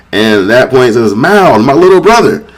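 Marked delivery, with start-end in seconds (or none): 1.2-2.5 overjoyed. Retold or imitated. Boar recognizes his lost brother.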